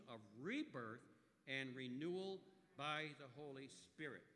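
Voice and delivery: slow scripture reading, each word weighted; slow, emphatic reading. Real, not acted. Pastor leading the congregation in reading Scripture together, slowly and emphatically. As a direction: lead a unison reading, leaning on every word. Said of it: "emphasize each and every word".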